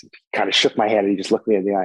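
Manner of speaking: ordinary talk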